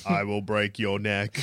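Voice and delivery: low, soft, slow modulated threat; voice modulator. Pretend. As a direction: drop low and slow like a voice changer. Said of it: "That's what the voice modulator says".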